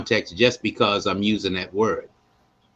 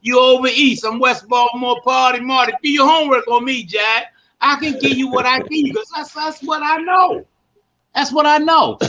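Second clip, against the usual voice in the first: high-pitched, hyped Baltimore street voice; Baltimore street talk; 11 semitones higher than usual. Shown as a demonstration. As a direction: talk big like a West Baltimore local. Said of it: "That's my regular conversation".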